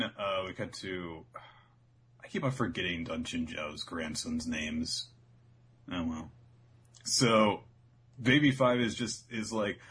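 usual speaking voice

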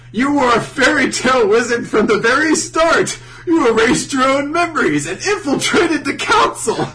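loud, high mock proclamation; dramatic revelation. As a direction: declaim the absurd plot twist. Acted dramatic revelation by Makarov.

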